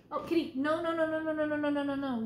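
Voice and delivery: loud rapid no, no, no; scolding the cat. Real, not acted. Catching the cat scratching the chair, loud scolding. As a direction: sharply stop the cat mid-scratch.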